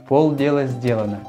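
ordinary talk, nothing marked

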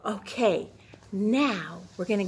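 whispered okay. Real, not acted. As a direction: lean in with a secret. Whispered transition.

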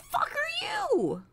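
very high, drawn-out bewilderment; startled disbelief. Real, not acted. Gamer meets an unknown thing, high drawn-out shock. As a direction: squeal it slowly in disbelief.

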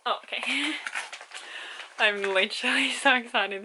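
ordinary talk, nothing marked

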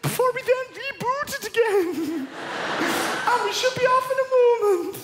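very high, loud Irish-Indian pilot voice; hammed-up Irish-Indian captain on the PA; Irish Indian accent. Ryanair pilot announcing engine reboot, high loud Irish-Indian accent. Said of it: "kind of Irish Indian".

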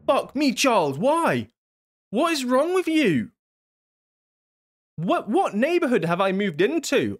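high, exasperated yelling at Charles; fed-up high cry at the creep; exasperated outburst. Real, not acted. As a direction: throw up your hands in disbelief.

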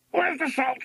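very high parrot shriek; shrieking macaw. A narrator's reading. Macaw demanding salt before flying off, shrieking. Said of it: "shrieked Macaw".

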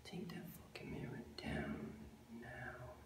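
catchphrase whispered, unvoiced. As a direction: breathe the slogan to yourself. Catchphrase whispered under breath.

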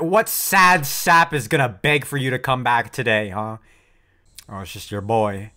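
usual speaking voice

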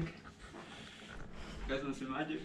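slow hushed whisper. Shown as a demonstration. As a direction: breathe it out like a secret. Repeats line as whisper.

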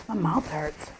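ordinary delivery

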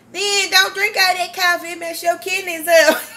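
high, loud, laughing mock scold; mock mom scolding. Daughter imitating mom warning about coffee, laughing. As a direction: scold through laughter like mama. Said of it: "she just told me".

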